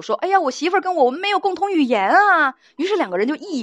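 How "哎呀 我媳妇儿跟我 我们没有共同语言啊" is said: higher-pitched mock lament. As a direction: act out a husband's exaggerated grumbling to his lover. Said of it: "他也跟情人诉苦". (抬高嗓音，夸张地学人诉苦)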